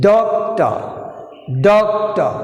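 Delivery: "Doctor" with a firm DOC and falling ending; teacher modelling "Doctor", stressed at the start; first-syllable stress. Shown as a demonstration. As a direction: push DOC, drop the rest of "Doctor". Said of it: "We have first syllable".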